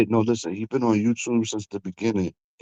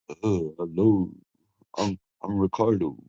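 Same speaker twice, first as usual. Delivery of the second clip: lower, softer smooth-talker voice on the party line; catfish voice; 5 semitones lower, 5 dB quieter than usual. Pretend. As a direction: play a smooth stranger introducing himself, a touch lower. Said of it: "My catfish voice".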